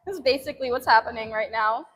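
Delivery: normal talking voice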